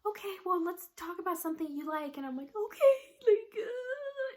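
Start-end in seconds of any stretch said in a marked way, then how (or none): 0.1-2.2 higher, coaxing nurse voice; lightly voiced nurse. Nurse to crying patient, lightly voiced coaxing. 2.6-4.3 high, loud blubbering; mock crying. Crying patient answering the nurse, hammed-up sobbing. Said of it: "just crying and crying and crying".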